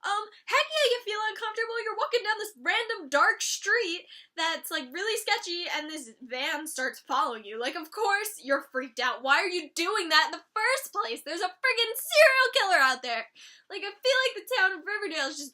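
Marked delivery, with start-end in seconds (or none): 0.0-4.1 high, loud sarcastic echo; mock her obvious statement, higher. Real, not acted. Vlogger mocking Barb's complaint, sarcastic and high. 9.2-13.3 loud, rising exasperated rant. Real, not acted. Vlogger ranting at Barb on the dark road, loud.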